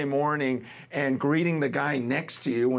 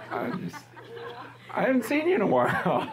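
laughing; 7 semitones higher than usual. Real, not acted. Laughing while greeting Liz.

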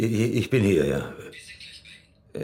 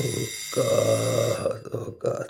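despairing whisper; 2 semitones higher, 5 dB quieter, much slower than usual. Acted. Cornered man breaks down.

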